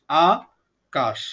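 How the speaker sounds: Akash stretched out very slowly, আ held long; slowly broken into syllables. Shown as a demonstration. Teacher slowly splitting Akash into syllables for the class. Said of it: "ধীরে ধীরে উচ্চারণ করব".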